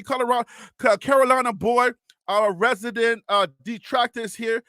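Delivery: ordinary talk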